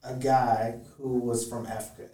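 usual speaking voice

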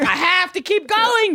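high, drawn-out mock plea; driven prepper.

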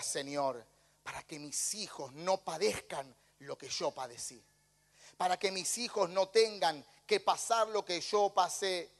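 ordinary talk, nothing marked

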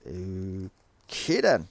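low, drawn-out dismissal; unimpressed. Real, not acted. Reviewer reacting to a silly film argument, unimpressed.

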